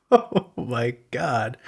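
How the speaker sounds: slow, laughing disbelief. Real, not acted. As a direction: laugh through the words.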